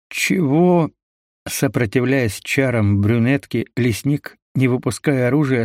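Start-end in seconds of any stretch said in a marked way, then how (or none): 0.1-0.9 sharp high surprised question. A narrator's reading. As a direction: blurt it out in shock. Sudden shocked reply.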